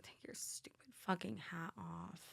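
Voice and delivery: hushed whispered insult; whispered aside. Acted. Telling the stranger off privately, whispered mock scorn. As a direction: whisper it, barely audible.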